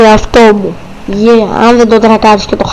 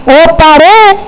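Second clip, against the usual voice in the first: startled shout; 4 semitones higher, 4 dB louder, slower than usual. Acted. Startled in the car.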